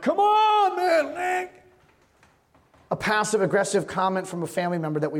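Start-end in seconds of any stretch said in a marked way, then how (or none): 0.0-1.6 mock road rage. Driver at a green light, mock angry shout. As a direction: yell at the car ahead like you've lost it.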